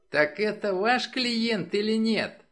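high, loud, squeaky demand; squeaking. A narrator's reading. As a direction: squeak the question out insistently. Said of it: "пропищал Джей Керр". (пискливо, высоким голосом)

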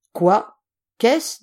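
startled, loud question with a sharp rise in pitch; gruff questioning on waking. Acted. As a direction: wake up and demand what is going on. General wakes with a start.

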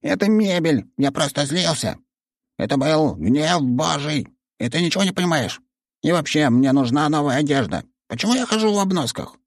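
high, indignant tantrum shout. Acted. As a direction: rant like an offended little god. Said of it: "закричал он".

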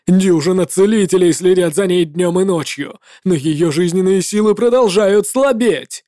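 a grieving knight shouting his grievance; angry paladin. Acted. Paladin blames the warlock.

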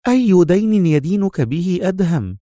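high-pitched woman's question; curious. A narrator's reading. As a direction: ask with high-voiced curiosity. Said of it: "تسأله في فضول".

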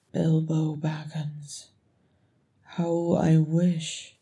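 slow, heavy lament; regretful. Acted. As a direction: dwell on each word with regret. Thorin voices his regrets.

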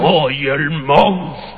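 slow, drawn-out monster voice. Shown as a demonstration. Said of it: "¿cómo es voz de monstruo".

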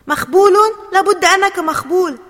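high, very loud accusation; angry shout. A narrator's reading. Asem running at Ali to push him, angry shout. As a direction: hurl the insult at full volume. Teammate rages at Ali after own goal.

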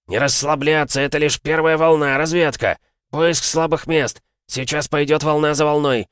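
rally the line like a wild beast; fired-up battle shouting. Acted. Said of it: "Капитан воодушевился, стал похож на дикого зверя".

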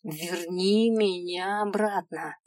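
slow, steady верни меня обратно with weight on верни; calm insistence. Acted. Girl repeating her demand to the imp, calmly insistent. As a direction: state it slow and firm.

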